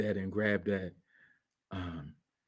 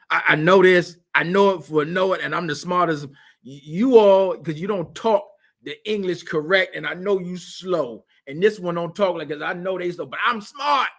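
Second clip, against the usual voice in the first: loud, higher, smug know-it-all mockery; mocking know-it-all; 8 semitones higher, 6 dB louder than usual. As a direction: lampoon the arrogant smart guy.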